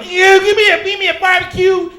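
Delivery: high, very loud mock order; hammed-up shouted food order; mocking loud customer. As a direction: imitate someone yelling an order. Mocks audience member ordering loudly.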